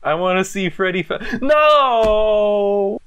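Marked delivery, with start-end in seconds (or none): 1.4-3.0 disappointed shout. Real, not acted. Cutscene disappoints.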